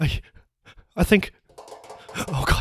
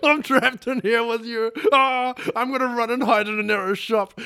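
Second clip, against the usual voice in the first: panicked; 6 semitones higher, 4 dB louder than usual. Acted. Trapped inside, runs to hide.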